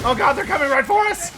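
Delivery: panicked yelling while fleeing; being chased. Real, not acted. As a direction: shout it in a panic, running. Fleeing a plague monk patrol.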